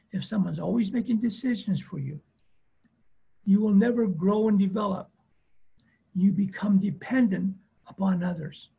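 ordinary delivery

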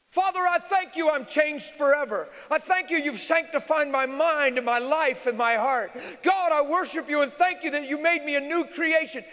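loud, high, fervent praying; loud fervent prayer. Retold or imitated. Preacher praying loudly to God in the service. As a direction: pray out loud with full force. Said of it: "I'd have scared you".

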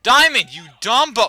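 very loud, high yell, cut off; furious shout. Real, not acted. Player yelling at the dog mid-game, furious shout. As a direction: explode at the dog.

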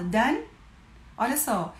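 ordinary talk, nothing marked

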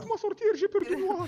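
high, loud cry to be let out; panicked plea. Acted. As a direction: yell in fear from a locked trunk. Locked in trunk, begging out.

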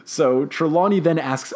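ordinary talk, nothing marked

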